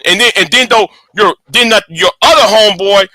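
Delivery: normal talking voice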